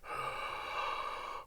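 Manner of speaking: soft startled intake of breath; shocked gasp. Retold or imitated. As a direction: gasp in horror. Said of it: "in their eyes like".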